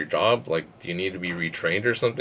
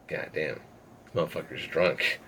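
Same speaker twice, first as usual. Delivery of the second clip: softer, slow, exasperated mutter; under-breath mutter; 3 semitones lower, 8 dB quieter, slower than usual. Retold or imitated. Annoyed at his drunk friend, a slow soft mutter. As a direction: say it to yourself, fed up.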